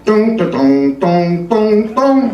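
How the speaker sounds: sung ringtone rising in pitch; mimicked cell phone melody climbing up; ringtone imitation. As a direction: chime it like a cell phone ring. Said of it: "I hear".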